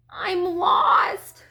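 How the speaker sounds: high, forlorn duckling; small sad voice. A narrator's reading. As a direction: wail it like a lost little one. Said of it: "he heard a small, sad voice".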